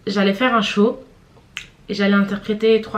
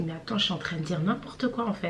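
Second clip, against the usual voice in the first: pensée intérieure; 3 semitones lower, 10 dB quieter than usual. Retold or imitated. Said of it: "je me dis".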